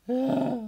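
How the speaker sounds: high, loud cry; sudden high-pitched yelp; startled shriek. Pretend. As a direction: shriek as the hunter comes into view. Sees a hunter's face in game.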